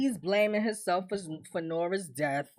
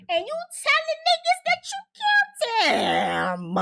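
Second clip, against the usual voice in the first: much higher, squeaky disbelief; mock exasperated Victor. Victor fussing at Tiffany over the confession, high-pitched. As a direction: squeal it like he can't believe her.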